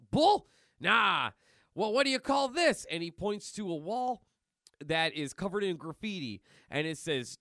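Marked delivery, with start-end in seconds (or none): 0.1-2.9 loud, hammed-up policeman; cartoon cop with a jump in pitch; indignant cop. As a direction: challenge them sharply. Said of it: "a cop goes".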